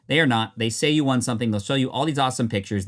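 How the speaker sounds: ordinary talk, nothing marked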